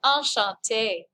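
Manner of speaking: Enchanté drawn out slowly, falling; savoured enchanté. Shown as a demonstration. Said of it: "Oh, ça c'est belle".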